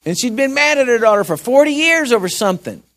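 ordinary delivery

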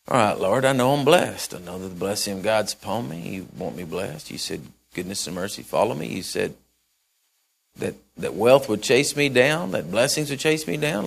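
hushed, low private prayer to the Lord; quiet prayer. Acted. Preacher voicing a believer praying, soft and hushed.

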